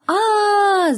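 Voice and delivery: a sudden high frightened "aaa"; startled scream; scared yell. A narrator's reading. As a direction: cry out in fright at a noise in the woods. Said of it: "закричал я".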